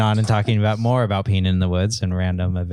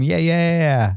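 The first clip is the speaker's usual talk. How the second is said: usual speaking voice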